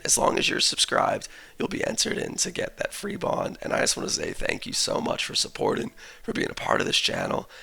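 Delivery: croaky, worn-out voice; hoarse voice. Real, not acted. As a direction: talk through a sore throat. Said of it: "my voice sounding like an actually dying frog".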